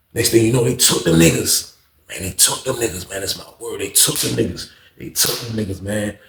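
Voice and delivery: usual speaking voice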